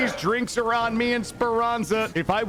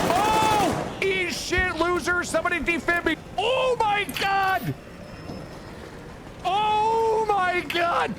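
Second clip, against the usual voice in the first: high-pitched wailing and taunting; frantic outcry; 9 semitones higher, slower than usual. Real, not acted. Downed gamer begs for defib, frantic outcry. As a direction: cry out high while downed.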